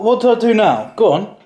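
ordinary delivery

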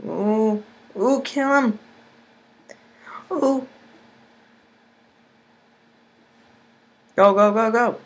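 high, loud cheering; excited cheering. Real, not acted. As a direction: urge the troops on, high and loud. Cheering the attack on.

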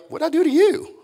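mock-innocent little brother. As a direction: protest like a wronged younger sibling. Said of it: "isn't this like a little brother".

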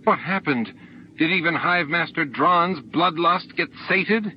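high, scornful questioning; bitter cry. A narrator's reading. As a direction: throw the accusation at him. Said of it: "Sana cried".

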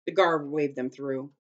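plain reading voice

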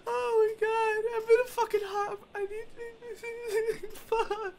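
high, frightened whimper. Real, not acted. Player rattled by a scare in the game, real whimpering. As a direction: whimper it, close to tears with fright. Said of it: "Just whimpering".

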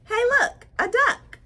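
high, loud exclamation; excited discovery. Acted. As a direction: call out to point out a find. Book character excitedly spots a duck.

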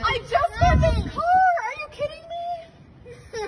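distressed crying. Real, not acted. Owner sees damaged car.

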